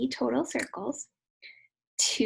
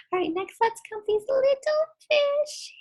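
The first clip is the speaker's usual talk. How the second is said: put-on kids show host; 5 dB louder than usual.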